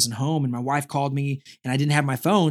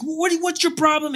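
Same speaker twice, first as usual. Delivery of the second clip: high-pitched retold confrontation; heated but not shouted; mad challenge; 12 semitones higher than usual. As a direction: imitate yourself confronting him, voice pitched up. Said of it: "and I'm mad".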